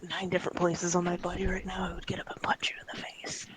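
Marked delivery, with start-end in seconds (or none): 1.9-3.5 hushed, breathy threat from bed; whispered threat. Real, not acted. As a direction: whisper it through the pain. Patient whispering threat from hospital bed.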